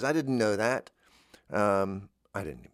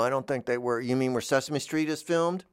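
ordinary talk

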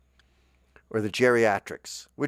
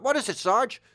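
much higher, eager soldier voice; private's reply; 14 semitones higher, 4 dB louder than usual. Pretend. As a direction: squeak up as the little private. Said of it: "there's nobody going".

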